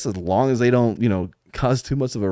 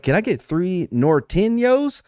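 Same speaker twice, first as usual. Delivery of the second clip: slow, higher-pitched ordering voice; careful order; 7 semitones higher, slower than usual. Retold or imitated. Customer ordering at the drive-through speaker, slow and careful.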